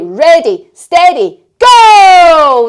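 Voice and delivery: rising to a loud shouted go; building ready steady go. Shown as a demonstration. To the kids at the start line, rising to a shouted go. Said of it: "we're just gonna say".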